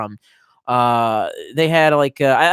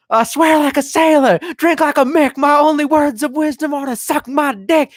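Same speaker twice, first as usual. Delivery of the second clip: mock crude sailor; 11 semitones higher, 4 dB louder than usual. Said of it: "when I got to the point where it was all like".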